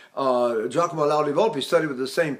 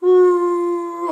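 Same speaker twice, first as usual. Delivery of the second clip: high, louder falsetto ooh with leaky breath; 12 semitones higher, 7 dB louder than usual. Shown as a demonstration. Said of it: "is this falsetto attachment".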